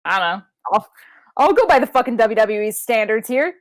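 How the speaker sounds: ordinary delivery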